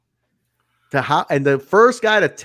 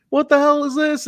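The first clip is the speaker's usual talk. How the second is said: much higher, bewildered host outburst; imitating the host finding his set wrecked, much higher; mock outraged Disco Inferno; 12 semitones higher than usual. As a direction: squeak out his disbelief. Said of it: "He's like".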